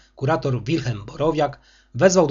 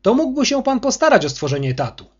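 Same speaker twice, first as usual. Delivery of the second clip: much higher, louder booming rebuke; thundering; 11 semitones higher, 5 dB louder than usual. Acted. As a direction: thunder down at the director. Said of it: "zagrzmiał kurator".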